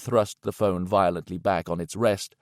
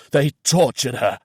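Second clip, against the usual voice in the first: "tortured" pushed harder, louder and higher; hoarse, louder repeat; 5 semitones higher, 7 dB louder than usual. A narrator's reading. Reynolds realising the horror, louder hoarse repeat. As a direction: repeat it, louder, breaking through. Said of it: "His voice was hoarse and unreal".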